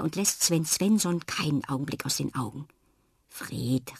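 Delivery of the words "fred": whispered name, low and soft; hushed murmur. Acted. Jana murmurs to Fred at the table, whispered. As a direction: lean in and murmur it. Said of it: "raunt Jana".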